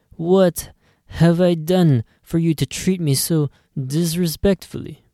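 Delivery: wounded Godfather-style reproach; offended Italian clerk. Acted. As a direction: clerk scolding a rude customer.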